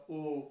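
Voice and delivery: ओ held out, loud; long vowel. Shown as a demonstration. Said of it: "ओ भी बोलने में ज़्यादा समय लगता है".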